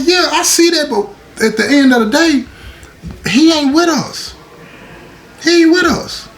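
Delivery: high-pitched insistent retelling, repeating the point; retold firm retort. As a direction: push your voice up high and insist he isn't one of us. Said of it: "I'm like".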